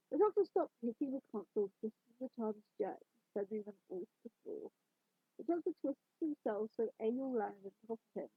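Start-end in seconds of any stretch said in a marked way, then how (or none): none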